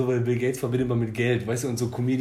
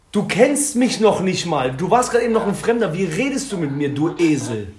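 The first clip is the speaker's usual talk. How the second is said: higher, louder mock yell at a stranger; retold yelling; 5 semitones higher, 5 dB louder than usual. Retelling how he shouted after a rude stranger, mock yell. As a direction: call out after someone, indignant.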